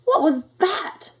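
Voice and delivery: high, drawn-out astonished question; startled. A narrator's reading. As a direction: squeal it in surprise. Startled kitten sees his reflection.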